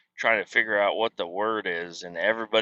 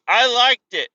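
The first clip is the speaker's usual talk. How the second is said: mock kid voice; 11 semitones higher, 7 dB louder than usual. Daughter voiced in retold chat.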